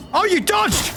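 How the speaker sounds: high-pitched disbelief; sudden high reaction to a dodge; surprised outcry. Real, not acted. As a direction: exclaim as the attack misses, voice up high. Enemy dodges the hit.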